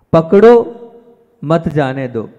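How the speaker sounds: loud, rising पकड़ो, pause, then मत जाने दो together; pause after पकड़ो. Shown as a demonstration. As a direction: cry पकड़ो, break, then say मत जाने दो as one phrase. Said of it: "यह है संसार की बात".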